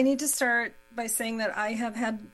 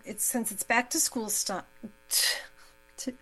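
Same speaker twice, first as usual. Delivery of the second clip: stumbling over the words; tongue-tied. Real, not acted. Host fumbling the back-to-school introduction, tongue-tied. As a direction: trip on the word and restart it. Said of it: "I'm tongue-tied".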